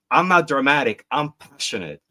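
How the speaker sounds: mild mock yell, starting high and loud, then easing off; half-hearted indignant yell, lightly acted. Quiz host voices the Five of Wands card, mild mock yell. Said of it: "The Five of Wands yelling".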